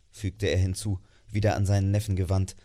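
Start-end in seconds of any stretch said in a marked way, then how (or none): none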